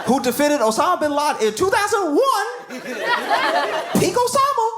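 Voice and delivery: comic asking the lawyer, high and stretched out; incredulous pleading. Real, not acted. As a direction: drag it out in amazed disbelief.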